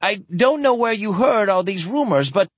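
high, desperate denial. Acted. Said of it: "Tanjin said desperately".

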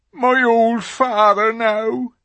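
slow, high, loud mourning fawn; mournful. A narrator's reading. Fawn mourning his father, slow and sad. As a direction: drag it out sorrowfully.

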